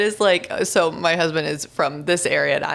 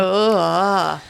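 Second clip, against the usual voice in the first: long, louder "ugh" of revulsion; disgusted groan; 3 semitones higher, 5 dB louder than usual. Real, not acted. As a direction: recoil in disgust. Disgust at creepy driver's move.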